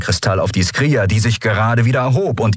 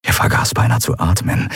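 ordinary delivery, nothing marked

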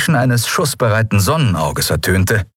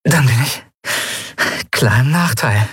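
smiling; 6 semitones higher than usual. A narrator's reading. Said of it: "Lien lächelte".

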